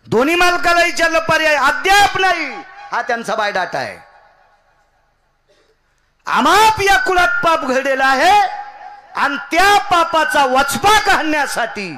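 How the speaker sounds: high, loud kirtan cry to the gods; preaching at full force. Acted. Preacher crying out to the gods in kirtan. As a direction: belt it out like a temple preacher.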